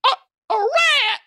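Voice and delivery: high, slow shriek of a startled lady; mock shriek. Fancy lady spots a rat under the table, mock shriek. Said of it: "one of the ladies said".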